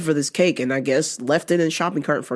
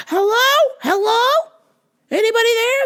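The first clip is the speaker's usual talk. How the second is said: put-on much higher, fussy older lady on the phone; confused lady caller; 3 dB louder than usual. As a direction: play the lost caller, pitch way up.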